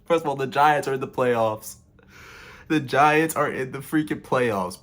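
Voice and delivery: choked-up excitement, voice lifted; emotional. Real, not acted. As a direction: near tears with pride over the news. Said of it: "has me kinda emotional".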